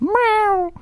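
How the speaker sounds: high meow through cupped hands; echoey cat meow. Shown as a demonstration. Co-host improving the cat in a Porsche, echoey meow. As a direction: meow as if echoing, not quite convincingly. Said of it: "tried to do a cupped hands thing".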